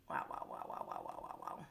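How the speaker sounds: breathless whisper, wow over and over. Real, not acted. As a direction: whisper it in shocked disbelief. Stunned reaction to show.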